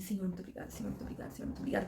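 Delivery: hushed, half-whispered grateful prayer; murmured thanksgiving. Acted. New hire thanking God privately after the news, murmured. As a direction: murmur thanks under your breath, over and over.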